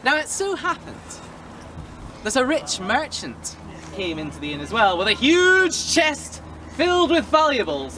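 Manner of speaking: ordinary delivery, nothing marked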